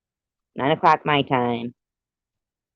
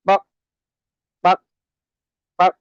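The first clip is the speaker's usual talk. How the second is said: chicken clucking; 7 semitones higher, 4 dB louder than usual. Retold or imitated. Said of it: "he's like".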